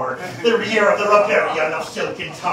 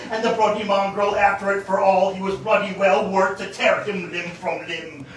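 usual speaking voice

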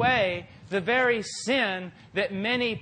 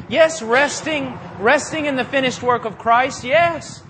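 loud, high burst of preaching; 5 semitones higher, 8 dB louder than usual. Acted. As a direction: lift the voice and proclaim it. Preaching, sudden loud emphasis.

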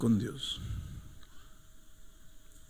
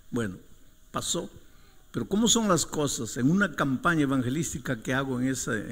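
usual speaking voice

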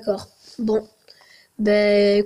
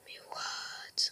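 whisper; 22 dB quieter than usual. Real, not acted. Scared surprise in dark dungeon.

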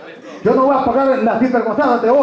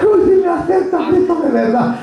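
high, lightly acted dying plea; mock dying convert; 8 semitones higher than usual. Imitated dying brother pleading with Christ, mock deathbed voice. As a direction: play a last-minute deathbed plea. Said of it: "va a estar ya agonizando".

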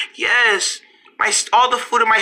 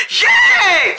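excited cheer; 7 semitones higher, 4 dB louder than usual. Acted. Kids cheer for a snack.

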